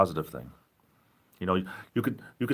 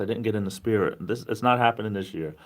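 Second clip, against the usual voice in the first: usual speaking voice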